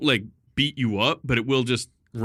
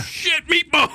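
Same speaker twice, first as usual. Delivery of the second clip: startled yelp; 4 dB louder, slower than usual. Retold or imitated. Panicked bird attacking his eye.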